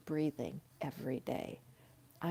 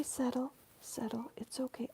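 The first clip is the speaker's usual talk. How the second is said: higher, fading to an unvoiced whisper; hushing the dog; 7 semitones higher, 4 dB quieter than usual. Real, not acted. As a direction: settle the dog softly down to a whisper.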